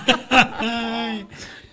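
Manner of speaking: high-pitched burst of laughter; amused high cackling laugh. Real, not acted. Dancer revelation, amused laugh.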